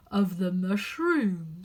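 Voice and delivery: slow, drawn-out creature voice; caterpillar reply. Acted. Caterpillar answering Alice's unspoken question, slow. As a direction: answer lazily, stretching every word. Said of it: "said the caterpillar".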